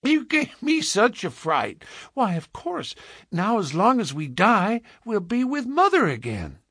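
put-on high, relieved little-girl chatter; piping voice of a happy small girl; gleeful child. A narrator's reading. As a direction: bubble over with innocent delight. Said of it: "laughing gleefully".

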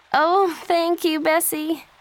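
high, loud, drawn-out delighted thanks; happy. A narrator's reading. Joey thanks her sister for the weekend off, happy. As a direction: gush with gratitude, voice lifted high. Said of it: "she said happily".

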